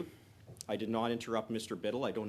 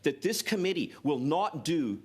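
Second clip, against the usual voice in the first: raised, forceful committee voice; loud speech; 4 semitones higher, 6 dB louder than usual. Real, not acted. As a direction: push the words out loud and firm. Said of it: "does he really have to shout?".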